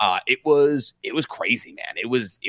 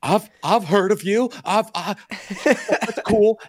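starstruck gushing; 8 semitones higher, slower than usual. Retold or imitated. Starstruck meeting a YouTuber.

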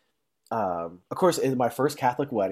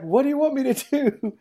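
much higher, bewildered old-man imitation; high mock grandpa. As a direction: play grandpa baffled, pitched way up. Grandpa answers in a put-on voice.

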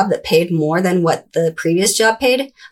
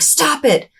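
mild mock yell; 8 semitones higher than usual. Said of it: "I would yell at him".

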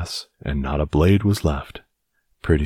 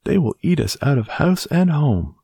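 put-on worried old woman; 11 semitones higher than usual.

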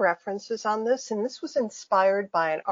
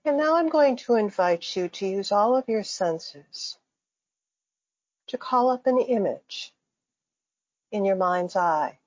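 guided meditation. Acted. Faculty member guiding listeners through breathing and imagery, calm meditation voice. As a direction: lead listeners gently into imagery, phrase by phrase.

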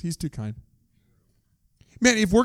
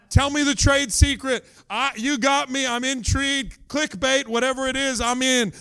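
eager; 7 semitones higher than usual. Retold or imitated. Woman eagerly hooked by clickbait.

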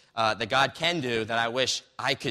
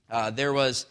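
normal talking voice

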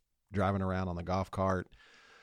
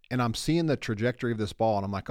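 normal talking voice

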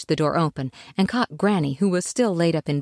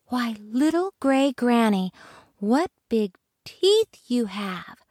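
put-on higher, slow girl voice; wide-eyed little girl asking; Little Red's voice; 6 semitones higher, slower than usual. Little Red to wolf, higher girl voice.

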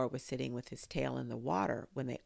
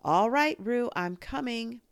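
high, loud urgent shout; calling out; 8 semitones higher, 8 dB louder than usual. A narrator's reading. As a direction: shout reassurance while running. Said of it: "calling out to the others".

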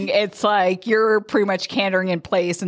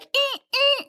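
high creaky Skeletor grunt; mock Skeletor. Horse turned Skeletor, mimicked by the rider, high creaky grunt. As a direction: imitate a bony cartoon villain, high. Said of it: "He turns into, like, a Skeletor".

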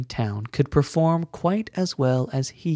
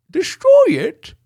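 very high excitable voice; high squeaky character voice; 10 dB louder than usual. Acted. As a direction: shriek it in a tiny high voice.

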